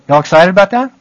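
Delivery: high, loud rallying question to the room; excited call-out. Real, not acted. Preacher rousing his listeners, excited and loud. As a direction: pump up the crowd with a rising, eager ask. Calling out to rouse the congregation.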